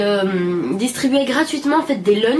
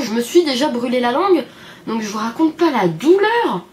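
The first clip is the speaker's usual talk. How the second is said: ordinary delivery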